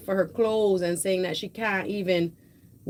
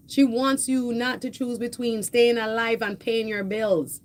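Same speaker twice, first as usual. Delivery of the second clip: normal talking voice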